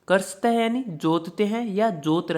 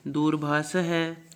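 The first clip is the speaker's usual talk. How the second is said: वृषभ है drawn out slowly; slow, clear reading; slow recitation; much slower than usual. Shown as a demonstration. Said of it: "आप साथ में उच्चारण कीजिए".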